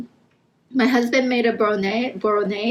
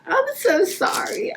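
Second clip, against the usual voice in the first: tearful apology, voice breaking; snotty crying; 9 semitones higher than usual. Real, not acted. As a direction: sob out the apology. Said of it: "ugly crying".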